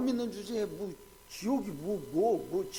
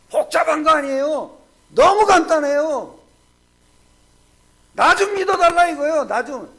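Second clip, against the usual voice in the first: full-force preaching; 5 semitones higher, 12 dB louder than usual. Real, not acted. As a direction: hammer home how simple it is. (느리고 크게 강조하는 설교)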